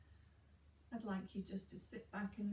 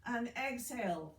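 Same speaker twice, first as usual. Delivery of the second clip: loud coaching; 5 semitones higher, 6 dB louder than usual. Shown as a demonstration. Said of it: "Breathe in".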